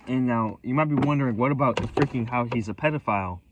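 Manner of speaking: normal talking voice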